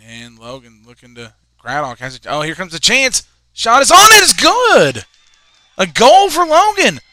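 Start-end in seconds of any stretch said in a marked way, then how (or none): 2.3-7.0 huge, high shouted goal call. Real, not acted. Commentator calling Logan's goal, screaming with joy. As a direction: explode as the ball hits the net.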